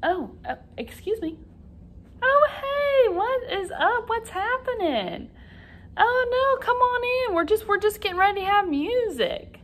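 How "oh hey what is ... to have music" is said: high, excited welcome to a guest; surprised greeting. Pretend. Teacher welcoming puppet Robert into class, high and excited. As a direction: act delighted someone dropped in.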